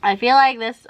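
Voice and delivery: usual speaking voice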